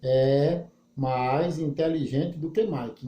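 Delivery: deliberate word-by-word model sentence; slow literal reading. Shown as a demonstration. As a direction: lay the words out one by one for the class. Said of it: "olha a literalidade presente aqui, ó".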